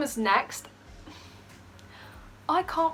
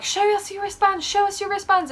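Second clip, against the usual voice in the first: security guard; 9 semitones higher than usual. Retold or imitated.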